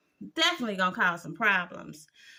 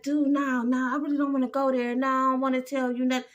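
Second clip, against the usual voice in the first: mock flustered Tony; 2 semitones higher, faster than usual. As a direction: gabble it out quick, wriggling out of it. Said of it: "Tony like".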